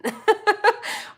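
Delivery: high, loud giggle; real burst of high laughter. Real, not acted. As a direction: crack up at your own remark. Amused by her own reaction.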